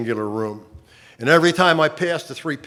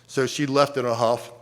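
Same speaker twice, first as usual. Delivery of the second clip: normal talking voice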